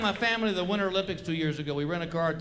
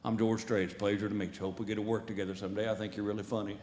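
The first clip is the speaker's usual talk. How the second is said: a cool cowboy star greeting a fan; deep relaxed cowboy voice; 10 semitones lower, 5 dB quieter than usual. Retold or imitated. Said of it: "He comes walking around the corner with that hat".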